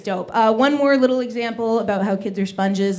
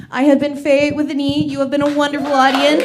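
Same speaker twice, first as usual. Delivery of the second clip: louder, higher emcee announcement; announcer sign-off; 6 semitones higher, 9 dB louder than usual. Acted. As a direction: hand the stage back like an announcer. Comic signs off, introduces host.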